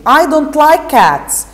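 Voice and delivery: the mouse snapping back, upset; loud voice. Acted. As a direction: mouse telling Alice off loudly. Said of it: "it said in a loud voice".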